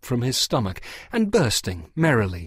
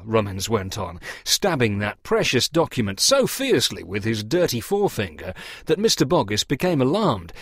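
usual speaking voice

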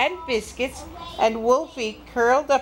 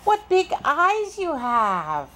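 higher, slow sing-song wonder; amazed little girl; 5 semitones higher, slower than usual. Acted. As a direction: marvel at Grandma, stretching it out. Girl amazed at strange Grandma.

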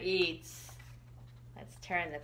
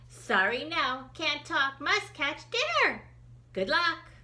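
hurried high bats; 9 semitones higher, 7 dB louder than usual. Acted. Bats rush off hunting.

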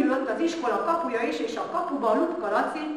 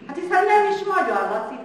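put-on eager child protest; 9 semitones higher, 6 dB louder, faster than usual. A narrator's reading.

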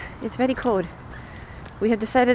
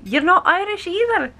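put-on a bit of an Irish accent, pitched up; 7 semitones higher, 4 dB louder than usual. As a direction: quote it with a lilting mock Irish accent. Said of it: "in a bit of an Irish accent".

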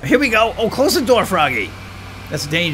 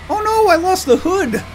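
dismayed; 5 dB louder than usual. Real, not acted. Car part falls off.

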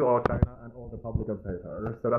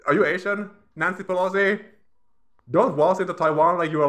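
mocking challenge; 7 semitones higher, 5 dB louder than usual. Real, not acted. Mocking question to politician.

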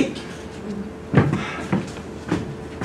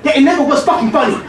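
higher and louder, fast and bitter; angry outburst; 6 semitones higher, 3 dB louder, faster than usual. Acted. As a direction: spit it out, hurt and furious. Son angry at father.

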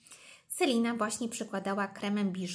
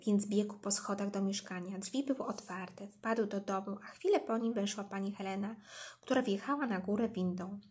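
ordinary delivery, nothing marked

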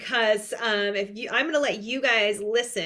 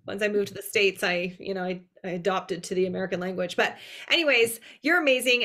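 usual speaking voice

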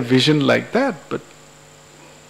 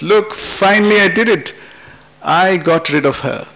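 higher, triumphant announcement settling into satisfaction; celebrating; 3 semitones higher than usual. Acted. Husband telling friends his good news, triumphant. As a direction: announce it proudly, starting pitched up. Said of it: "he's in a mood to celebrate".

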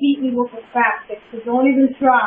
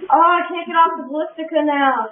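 normal talking voice

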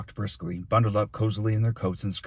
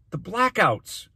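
high, forceful outburst; urgent cry; 12 semitones higher, 4 dB louder, slower than usual. A narrator's reading. Boy shouting back at the mayor, urgent cry. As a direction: cry it out over the interruption. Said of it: "cried Dune".

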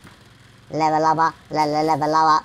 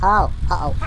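ordinary delivery

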